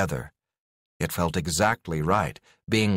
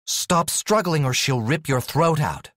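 higher-pitched, urgent threat; trying to sound threatening; 7 semitones higher than usual. A narrator's reading. Alex warns the pinned man, trying to threaten. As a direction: put on a threatening front. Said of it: "trying to make her voice sound threatening".

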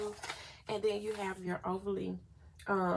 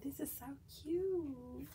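gushing; 6 semitones higher, 6 dB quieter than usual. Real, not acted. Gushing over cute page.

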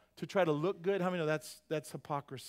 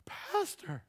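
high squeal of mock shock at the pastor; mock shocked gasp; 12 semitones higher than usual. Congregant catching the pastor at a bar, mock shocked squeal. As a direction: pitch it way up like a scandalized churchgoer.

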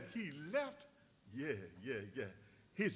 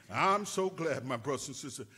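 normal talking voice

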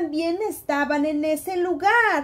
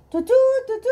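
high, louder tu tu tu like a trumpet; trumpet call; 9 semitones higher, 4 dB louder, much faster than usual. Acted. Storyteller imitating the king's trumpet, high and bright. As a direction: toot it out like a royal trumpet. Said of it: "la trompeta empezó a sonar".

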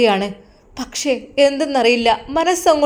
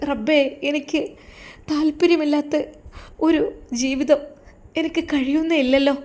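higher, soft and timid pleading; lightly voiced scared bride, higher and soft; frightened young wife; 3 semitones higher, 4 dB quieter than usual. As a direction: plead softly, scared of him. Wife's anguished prayer.

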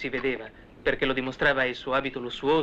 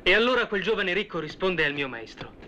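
normal talking voice

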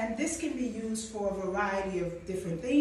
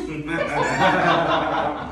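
loud laugh; 4 semitones lower, 13 dB louder than usual. Real, not acted. Chef laughs while pouring whiskey.

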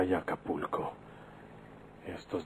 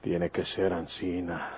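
ordinary talk, nothing marked

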